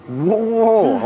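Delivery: high startled whoa; alarmed whoa. Retold or imitated. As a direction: stop her in alarm. Retold stopping artist again.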